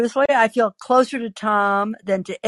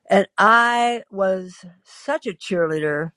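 ordinary delivery

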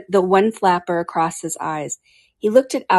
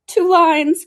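high, loud storybook turtle cry; excited cry; 11 semitones higher, 7 dB louder than usual. A narrator's reading. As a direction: call it out high and eager. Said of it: "cried the Mock Turtle".

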